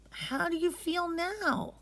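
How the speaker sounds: put-on high, slow, soft boy's question; a little brother checking on her; gentle young boy. Boy asking his sick sister softly. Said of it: "Majid asked".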